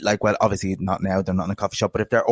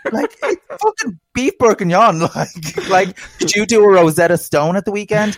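mock incredulity; 8 semitones higher, 3 dB louder than usual. Mock outrage at pretentious French words.

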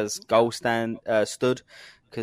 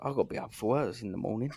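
fast grumble; 7 dB quieter, much faster than usual. Retold or imitated.

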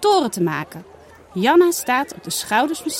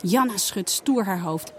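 ordinary delivery

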